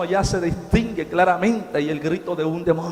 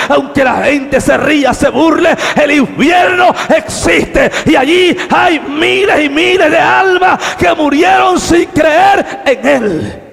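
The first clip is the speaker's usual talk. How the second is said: loud, high preacher's cry; full-force preaching; 9 semitones higher, 12 dB louder than usual. Acted. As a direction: preach it out to the whole church.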